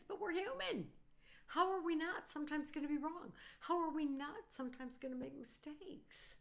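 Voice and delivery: exasperated plea. Real, not acted. Exasperated plea about being human.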